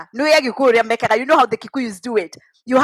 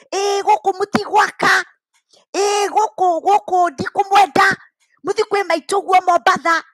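angry shouting; 7 semitones higher than usual. Retold or imitated. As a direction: yell the threat at his sons. Dad threatening brothers again, Kikuyu.

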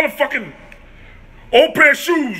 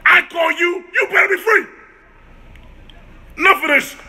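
angry rant; 6 semitones higher than usual. Real, not acted. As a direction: tell her off at full volume. Ranting at the girl who calls.

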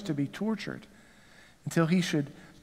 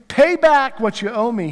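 a forceful, louder demand; 5 semitones higher, 7 dB louder than usual. Acted. Servant throttling his fellow servant, loud demanding. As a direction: bark the debt at him. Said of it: "he demanded".

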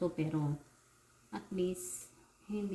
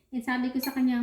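ordinary delivery

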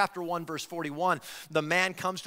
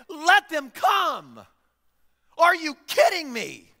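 much higher and louder, exasperated outburst; indignant shout; 12 semitones higher, 10 dB louder, slower than usual. Acted. Jesus rebuking the disciples for blocking the children, loud indignant shout. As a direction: yell at the disciples, fed up and furious. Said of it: "he's indignant".

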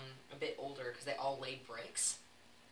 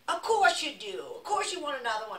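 loud, high mock Boston accent; boisterous Boston bar guys, badly imitated; attempted Boston accent; 12 semitones higher, 11 dB louder than usual. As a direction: holler it in a hammed-up Boston accent. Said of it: "I can't do the accent".

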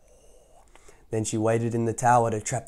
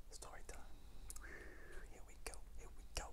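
fast excited whisper; 25 dB quieter, much faster than usual. Acted. Storyteller whispering the start to children. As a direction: hush it like a secret.

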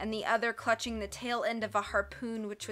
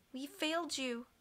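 soft lament; 5 semitones higher, 7 dB quieter than usual. A narrator's reading. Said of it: "Sunny said".

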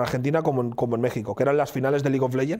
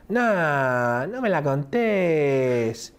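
slow, sing-song Argentine drawl, pitched up; exaggerated Argentine accent; much slower than usual. Imitating Papo's Argentine to the group, exaggerated sing-song. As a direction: lay the Argentine singsong on thick and slow. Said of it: "Lo pongo de nuevo".

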